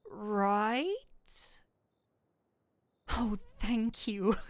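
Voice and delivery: a coy, drawn-out surrender; coy giving in. Acted. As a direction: pretend to resist, then give in. Pretends reluctance, then accepts.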